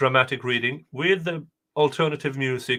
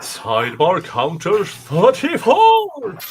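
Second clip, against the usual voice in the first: announcer voice; 7 semitones higher, 9 dB louder, slower than usual. Pretend. Mock announcing sidebar count.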